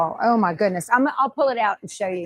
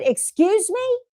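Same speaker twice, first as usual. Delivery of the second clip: sharp, much higher indignant "Excuse me?"; indignant retort; 11 semitones higher, slower than usual. Retold or imitated. Retelling how she snapped back at the food truck owner, indignant. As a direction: bristle at the insult, high and offended. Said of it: "Man, I got pissed".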